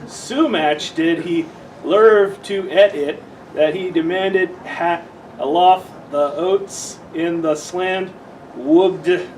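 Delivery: ordinary delivery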